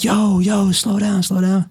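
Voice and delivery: retold shout after the bike crash; urgent yell. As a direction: yell at the driver to slow down. Yelling at driver after crash.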